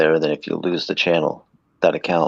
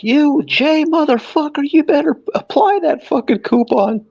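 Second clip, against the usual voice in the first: angry customer rant; 4 dB louder than usual. Retold or imitated. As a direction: squeal it up high like an outraged shopper. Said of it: "then I'm like".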